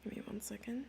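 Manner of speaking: whispered aside off mic; a quick hushed request. Real, not acted. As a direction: murmur it under your breath. Stepping away from stream.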